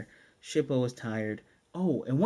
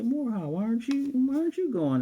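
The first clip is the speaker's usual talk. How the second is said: much higher, puzzled questioning; voiced Kagome; 11 semitones higher, 4 dB louder than usual. Recapper as Kagome asking Moroha why, high questioning voice. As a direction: imitate a high-pitched, insistent question.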